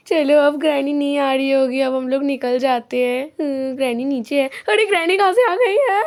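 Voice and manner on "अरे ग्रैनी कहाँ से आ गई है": high-pitched shocked yelp; startled alarm. Acted. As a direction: blurt it out as danger suddenly appears. Startled as Granny suddenly appears. (चौंककर घबराई ऊँची आवाज़)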